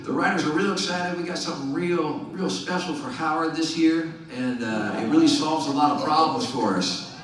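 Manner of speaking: high-pitched, badly faked Virginia accent; hammy Virginia accent; [name]'s terrible Virginia accent. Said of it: "This is a terrible Virginia accent".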